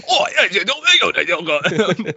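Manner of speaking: high-pitched mock Cockney; bad Cockney accent. Friends mocking Mary Poppins, bad high Cockney accent. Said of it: "That's the worst accent we've ever seen".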